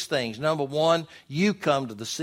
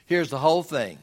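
usual speaking voice

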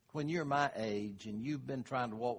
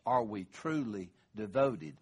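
ordinary talk, nothing marked

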